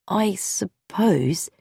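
very slow, softened hesitation; doubtful. A narrator's reading. Son answers his father's theory, slow and doubtful. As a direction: drag the words out, unconvinced. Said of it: "said Bobby doubtfully".

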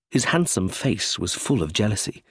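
ordinary delivery

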